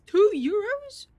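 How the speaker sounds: high, loud, slow disbelief; shocked. Real, not acted. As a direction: gasp out the price in shock. Shocked at price.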